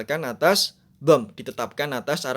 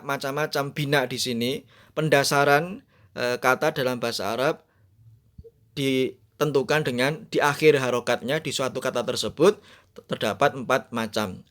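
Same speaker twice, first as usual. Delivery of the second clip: normal talking voice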